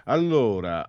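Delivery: usual speaking voice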